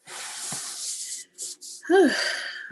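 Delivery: a long, high, breathy sigh holding back tears; emotional sigh. Real, not acted. Missing her late mom, she sighs to the viewers. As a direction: let out a shaky sigh before you cry. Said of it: "Almost teared up there".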